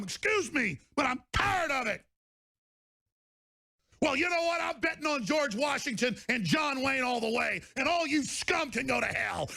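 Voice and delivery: high, raging tirade; angry shouting. Real, not acted. Host shouting down his enemies in fury. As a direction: bellow your defiance at them.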